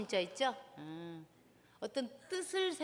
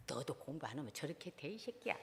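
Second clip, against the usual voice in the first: muttered threat; 10 semitones lower, 12 dB quieter than usual. Retold or imitated. Mother scolding child at construction site.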